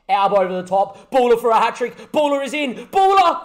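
urgent commentary rising to a shouted goal call; excited play-by-play. Acted. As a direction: call the attack, erupt on the goal. Live commentary on own match.

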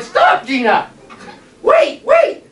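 high, loud urgent call. Retold or imitated. Boy calling after his running sister, loud. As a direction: shout after her to wait. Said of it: "And I said".

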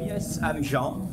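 normal talking voice

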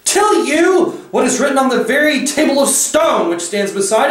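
high, shrill, imperious tirade; shrill witch. A narrator's reading. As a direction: hammer out each claim in a shrill witch voice. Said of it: "her voice growing suddenly shriller".

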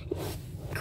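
slow soft whisper. Real, not acted. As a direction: drag it out in a whisper. Cramped in the fort.

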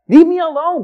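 loud, high exasperated outburst; exasperated retort. Retold or imitated. Man thinking at a chatty woman, exasperated. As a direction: burst out to shut the chatterer up.

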